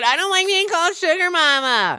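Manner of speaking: high, mock-whiny quoting voice; mocking whiny quote. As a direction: mimic her to make fun of her. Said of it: "as you just said".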